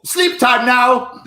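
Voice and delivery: silly bedtime announcement. Retold or imitated. Guest acting out his bedtime after eating, slow silly announcement. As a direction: announce it loudly, stretching every word. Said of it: "that's how you really go to bed".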